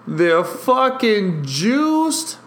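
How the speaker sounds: high, slow drawn-out exclamation; thrilled fan, drawing out the words; excited game reaction. Real, not acted. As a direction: stretch it out high as the bases load. Bases load up during live game.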